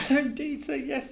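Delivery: high squeaky elf voice, anxious; a fretful little elf begging; pleading house elf. A narrator's reading. Dobby nodding eagerly.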